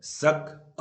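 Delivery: सक alone, loud and drawn out; teacher isolating सक from the word; isolated syllable. Shown as a demonstration. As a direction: punch सक slowly as the first beat. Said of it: "तीन syllable word होगा".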